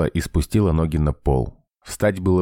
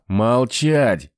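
sharp barked order; 6 semitones higher than usual. A narrator's reading. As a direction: snap it like a drill sergeant. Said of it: "рявкнул командир".

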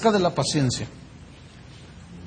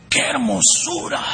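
awed exclamation; 4 semitones higher than usual. Acted. Sculptor marvelling at a misshapen marble block, awed exclamation. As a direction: marvel aloud, stretching the word out.

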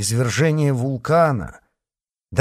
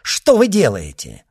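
loud, alarmed question; raised, urgent objection; alarmed exclamation; 3 semitones higher, 6 dB louder than usual. A narrator's reading. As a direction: exclaim in protest. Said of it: "воскликнул Мазарини".

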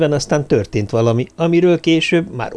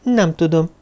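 ordinary delivery

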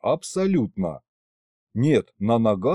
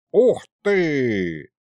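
loudish, higher exclamation of wonder; amazed; 4 semitones higher than usual. A narrator's reading. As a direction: burst out impressed. Sorcerer bursts out of the wall amazed. (изумлённо, с восторгом)

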